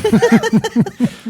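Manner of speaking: loud high cackle; high burst of laughter. Real, not acted. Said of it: "Did it again".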